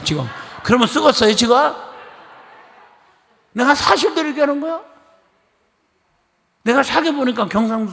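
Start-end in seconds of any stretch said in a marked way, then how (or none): 0.6-4.8 teasing scold. Real, not acted. Teasing congregation in dialect.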